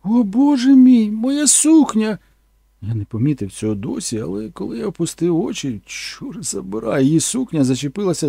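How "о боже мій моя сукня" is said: high, loud, slow shriek; shocked scream. Retold or imitated. Actress discovering her dress torn off, loud high slow shriek. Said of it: "Раптом вона закричала".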